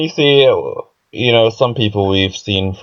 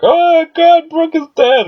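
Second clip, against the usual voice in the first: much higher, louder hammed-up panic; mock panic; 5 dB louder, slower than usual. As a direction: shriek it as Luffy in fake alarm. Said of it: "Luffy looks like he's worried".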